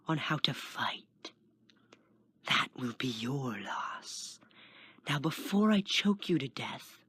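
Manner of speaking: smooth, sinister whispering; snake-like whisper. A narrator's reading. Skinny man threatens pinned Kenton, snake-like whisper. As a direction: whisper like a snake into his ear. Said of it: "whispered like a snake".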